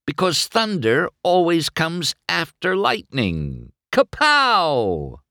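high, showy punchline; shooting back. A narrator's reading. Racer tops his rival's jibe, showing off. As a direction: land the comeback with a flourish. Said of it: "McQueen shot back".

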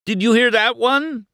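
very high, loud hilarity; acted uproarious amusement; hysterical amusement. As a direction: crow it as if cracking up. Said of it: "was laughing hysterically".

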